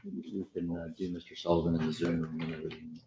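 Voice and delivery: very soft, low, quick murmur; quiet aside. Real, not acted. Judge repeating for staff, in a hushed quick aside.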